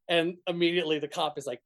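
ordinary delivery

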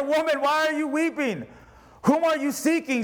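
preached scripture reading. Acted. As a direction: read Jesus' words with lift.

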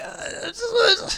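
hammed-up whimper of frustration; mock whine. Retelling his reply to the clerk, mock crying whine.

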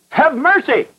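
high-pitched retold plea; imitated cry of a desperate prayer; crying supplication. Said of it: "He shouted, cried, and he made supplication".

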